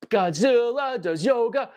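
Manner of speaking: high, slow put-on voice; mock-grand toy-monster patter; playful silly voice. Playful riff on his Godzilla.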